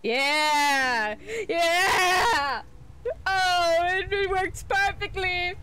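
high-pitched, gleeful plotting; thrilled, building to whooping yeahs; excited scheming. Real, not acted. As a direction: get carried away by your own brilliant plan. Hatching a gleeful plan in game.

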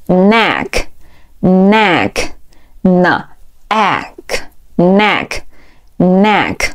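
"neck" very slowly, with pauses between sounds and repeats; model pronunciation. Shown as a demonstration. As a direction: say "neck" drawn out for learners to repeat. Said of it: "边看视频边跟读". (慢速领读neck，逐遍停顿)

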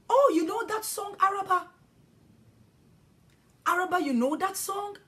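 put-on children calling to a friend who knows the song; excited children. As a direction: call out to Araba delightedly.

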